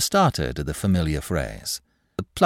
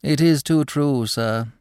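high-pitched mock regret; sighed; 8 semitones higher, slower than usual. A narrator's reading. Clerk concedes to the manager, sighing. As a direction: agree with a touch of lament. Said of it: "sighed Smith".

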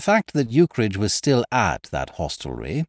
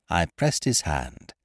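ordinary delivery

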